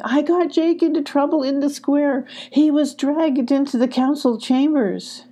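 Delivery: high-pitched, upset confession; crying. A narrator's reading. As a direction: tell it in tearful distress. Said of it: "said Emmy, crying".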